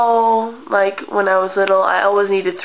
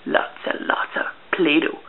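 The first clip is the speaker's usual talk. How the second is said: softer, breathless whisper about Play-Doh; excited whisper; 4 semitones lower, 5 dB quieter than usual. Retold or imitated. Child whispering over a pile of Play-Doh, awed whisper. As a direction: whisper it in awe at the treasure. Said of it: "I was like".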